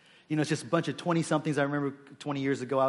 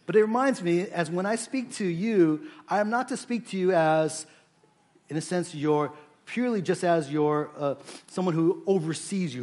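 ordinary talk, nothing marked

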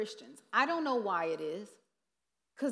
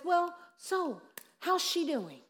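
higher, slow breezy question; cluelessly cheerful, drawn out; mock chipper chaplain; oblivious chaplain; 7 semitones higher, slower than usual. Clueless chaplain asks again.

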